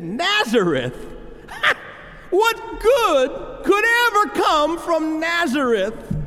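sneering quote with a scoffing laugh, "ever" pushed up high and slow; scornful mockery. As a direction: scoff at the small town, laugh it off, then drag out the question. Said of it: "He said".

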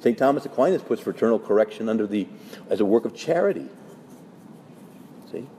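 ordinary talk

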